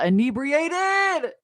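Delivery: very drawn out, pitched high; theatrical, slow high sing-out; hammed-up drawl. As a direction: stretch the word out comically. Hammed-up drawn-out confession word.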